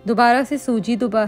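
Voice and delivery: ordinary talk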